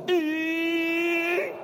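high drawn-out crying wail, mimicked; imitated sob. Pastor mimics the brother sobbing too hard to talk. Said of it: "He couldn't talk".

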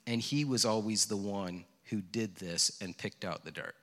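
low, soft widow's confession; retold grief. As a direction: gently relay a bereaved woman's words. Retelling a widow's confession of loss.

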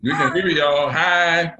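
loud, high cheery hello; calling out a greeting. Real, not acted. Speaker to listeners on the call, loud high greeting.

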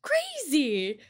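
high, drawn-out amazement; amazed reaction. Retold or imitated. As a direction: stretch it out in high-pitched disbelief. Retold amazed reaction to accent.

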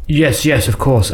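rattled underling; flustered stutter. A narrator's reading. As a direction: agree hastily, tripping over words. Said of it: "Elmander stuttered".

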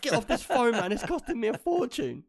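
high, loud angry mum voice; man doing a woman's voice. Retold or imitated. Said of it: "She'd be like".

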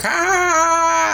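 hammed-up despairing cry; mock cry of dismay. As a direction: yelp it out like your momentum just collapsed. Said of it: "all of a sudden it's like".

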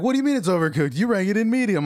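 high-pitched defensive cook; indignant kitchen pushback. Acted. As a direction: throw the blame back at the server. Acted kitchen quarrel over overcooked order.

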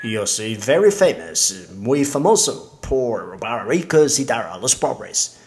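gringo speaking broken Spanish; American accent Spanish. Acted. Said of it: "disculpen mi español".

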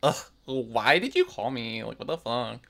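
groaning, annoyed female demon; frustrated groaning. Retold or imitated. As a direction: sigh it out, fed up at being summoned. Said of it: "what would the female one be like".

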